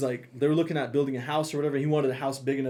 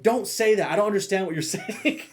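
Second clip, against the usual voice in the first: pissed off; 6 semitones higher than usual. Retold or imitated. Partner objects to his joking Spanish, mock pissed off. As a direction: imitate an irritated partner, voice pitched up. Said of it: "she gets pissed off".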